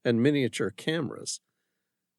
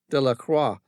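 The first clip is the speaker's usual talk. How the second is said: ordinary delivery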